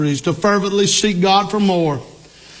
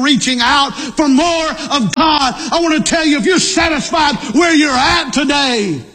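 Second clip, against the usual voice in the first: raised-pitch, urgent pulpit exhortation; fervent preaching; 4 semitones higher than usual. Real, not acted. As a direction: press the congregation hard, pitch held high.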